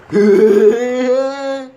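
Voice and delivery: high loud laugh; burst of laughter. Real, not acted. Player laughing at a joke he made.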